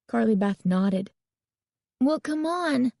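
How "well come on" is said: high, impatient nagging; pitched-up impatient prodding; impatient urging. A narrator's reading. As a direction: hurry a friend along, fed up. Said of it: "she urged impatiently".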